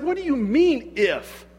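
high incredulous question; challenging. Retold or imitated. Jesus challenging a doubter's view of him, incredulous. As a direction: pitch up in disbelief, dropping on the last word. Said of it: "he's challenging my mentality".

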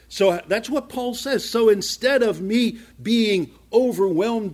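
fervent pulpit crescendo; full force preaching. Acted. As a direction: preach it out to the congregation.

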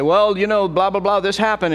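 complaining voice up high; mock complainer. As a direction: whine about the bad news. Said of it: "you say".

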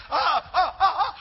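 high, loud panicked stammer; hammed-up panicky teen; mock panic. Teen grabbed for his earbud, in mock panic. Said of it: "they're like, panic, panic".

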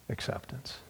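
soft whispered awe; hushed reverent whisper. Real, not acted. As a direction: let it fall to a whisper. Tender close about Heavenly Father.

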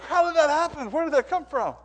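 bewildered. Retold or imitated.

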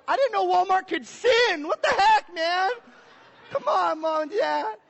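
high, loud wailing complaint; hammed-up tearful protest to the parents; mock crying. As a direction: whine it like a betrayed eleven-year-old. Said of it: "I started to cry and weep".

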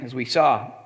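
ordinary talk

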